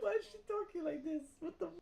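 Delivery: very high, incredulous squeal; high-pitched baffled outburst. Real, not acted. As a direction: squeak it out in amused disbelief. Baffled, amused by how she talks.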